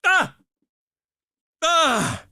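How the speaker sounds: two high, loud yelps of price shock. Retold or imitated. Telling a friend about seeing the card's price, with pained high yelps. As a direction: yelp like the price just hit you in the gut. Reacting to graphics card price.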